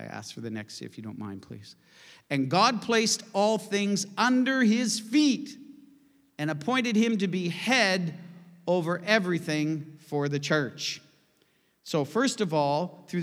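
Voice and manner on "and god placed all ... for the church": high, slow proclamation; solemn, lifted reading of the text; scripture reading. Acted. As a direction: declare it slowly and loud. Reads the day's scripture.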